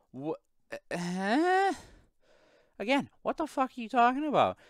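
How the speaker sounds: very high-pitched, baffled retort; incredulous. Retold or imitated. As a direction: act out utter disbelief at an accusation. Retells his growing bafflement at coworker.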